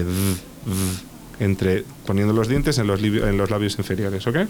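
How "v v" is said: "v" with top teeth on the lower lip, buzzing; a soft buzzed "v", teeth touching the lower lip; fricative v sound. Shown as a demonstration. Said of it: "haciendo el sonido este". ("v" fricativa, dientes sobre el labio inferior)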